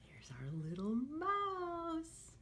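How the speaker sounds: sing-song storybook read for little ones; lively picture-book voice; reading to children. Acted. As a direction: read it like a teacher at story time. Teacher reads picture book aloud.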